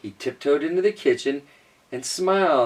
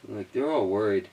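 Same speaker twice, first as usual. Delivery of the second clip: plain reading voice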